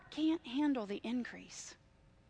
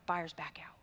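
whisper; 7 semitones lower, 9 dB quieter than usual. Retold or imitated. Retelling houses falling through.

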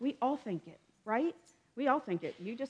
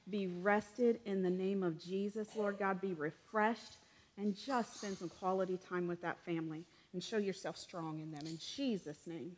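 prayer; 5 dB quieter than usual. Acted. Pastor praying over the congregation.

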